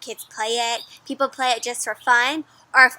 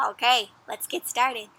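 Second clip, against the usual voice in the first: usual speaking voice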